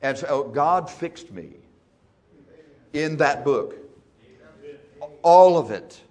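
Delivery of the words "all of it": "All of it" called out loud; loud exclamation. Real, not acted. Preaching to the congregation, a loud cry. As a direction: declare it full voice.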